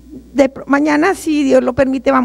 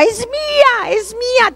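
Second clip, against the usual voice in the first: shrieking creature; 10 semitones higher, slower than usual. Retold or imitated. Horrible creature claiming her soul, high mock shriek. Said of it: "horrible criatura que gritaba".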